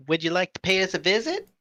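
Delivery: high, airy fairy voice. Acted. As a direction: sing it sweetly like a tiny fairy.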